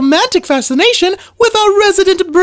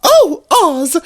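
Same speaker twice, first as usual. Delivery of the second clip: ordinary talk, nothing marked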